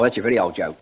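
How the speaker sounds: normal talking voice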